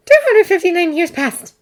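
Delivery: high, bright sheepish woman's voice; fairy admitting. Acted. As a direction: own up with a fluttery lilt. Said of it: "Tooth admitted".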